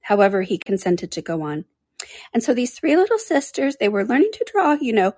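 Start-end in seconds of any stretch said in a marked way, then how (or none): 2.3-5.1 high little creature telling a tale; high-pitched, rambling animal voice; dormouse storyteller. Acted. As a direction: tell it in a small, high voice.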